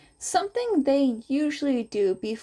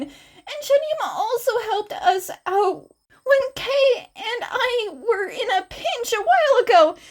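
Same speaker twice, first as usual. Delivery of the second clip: high, loud, flustered rush; frantic high-pitched reassurance; half frantic; 13 semitones higher, 5 dB louder than usual. A narrator's reading. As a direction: babble it out, flustered and embarrassed. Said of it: "his voice was half frantic".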